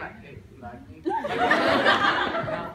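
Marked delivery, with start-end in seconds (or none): 0.2-1.0 "Hey, do you like me?" softly, with no expression; bland. Shown as a demonstration. Language teacher demonstrating for the audience, "Hey, do you like me?" said blandly. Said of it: "you even go bland".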